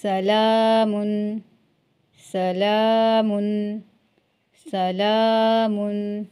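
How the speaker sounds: सलामुन slowly, long आ held; tajweed recitation. Shown as a demonstration. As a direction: recite सलामुन for students to repeat. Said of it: "आगे पढ़ेंगे". (धीरे खींचकर तिलावत)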